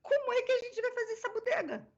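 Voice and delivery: very high retold wail; exasperated mock panic. Dubber stuck on one word asks director, exasperated mock panic. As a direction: squeal it as a comically helpless question.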